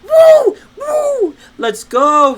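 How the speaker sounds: high, loud whooping cheer. Retold or imitated. Hearing school is canceled for the year, loud whooping cheer. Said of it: "I was like".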